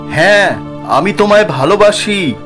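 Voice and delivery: high, swooping, unafraid declaration; fearless answer. Acted. Arindam answers the ghostly woman without fear, bold high-pitched declaration. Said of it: "কিন্তু ভয় না পেয়ে জবাব দেয়".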